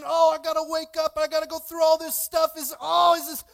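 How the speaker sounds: loud, high overwhelmed complaint; acted exasperation. Churchgoer facing Monday again, acted loud exasperation. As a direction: groan it out as mock overload. Acts out dreading another Monday.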